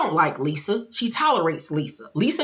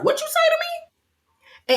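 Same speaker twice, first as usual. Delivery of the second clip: mock outraged retort; slower than usual. Mary offended retort.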